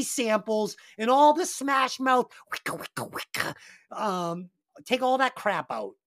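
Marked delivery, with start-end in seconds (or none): 2.5-3.5 whispered turntable scratching noises; imitated record scratch. Reviewer mocks the shitty samples, imitated scratching. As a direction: scratch like a DJ with voiceless mouth sounds.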